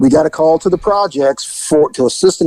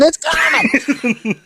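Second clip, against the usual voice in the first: slow, high laughing scream; 12 semitones higher, much slower than usual. Retold or imitated. Rookie screaming in panic, told laughing. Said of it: "Burgess screaming".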